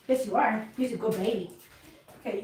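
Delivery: ordinary delivery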